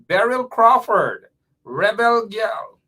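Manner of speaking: loud, drawn-out shout-out; calling out. Real, not acted. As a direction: hail a listener by name, slow and high. Calling out viewers' names on air.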